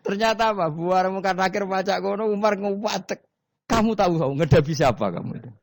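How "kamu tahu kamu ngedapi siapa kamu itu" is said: mock stern challenge. Umar snaps at grave angels.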